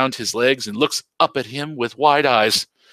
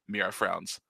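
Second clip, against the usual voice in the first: ordinary delivery, nothing marked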